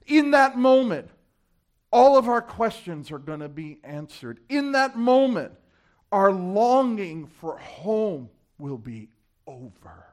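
high, loud cry easing into slow close; full-force preaching. Real, not acted. Preacher promising the end of longing, forceful then slowing. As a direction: hit the refrain hard, then stretch out the promise.

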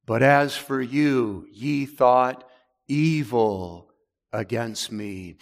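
slow, weighty scripture reading with long pauses; slow solemn reading. Shown as a demonstration. Preacher reads Joseph's words to brothers slowly and gravely. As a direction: draw each word out as holy text. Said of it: "that word evil emphasizes".

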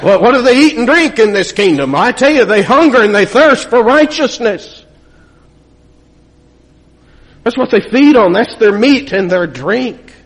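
preacher lifting the congregation, voice rising; preaching at full force. Acted. Pastor telling the congregation who mourns over sin, preaching at full force.

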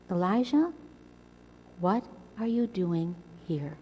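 a gentle hushed question from God; still small voice. Retold or imitated. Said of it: "God comes to him in a still, small voice".

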